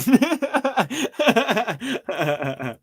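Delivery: high, helpless cackling; real laughing fit. Real, not acted. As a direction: laugh long and hard at your own tease. Laughing at own tease.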